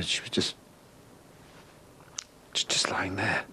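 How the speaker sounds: breathless whispered grief; whispering over the body he found; grieving whisper. Real, not acted. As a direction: let it fall to a whisper, stunned. Confessing finding dead wife.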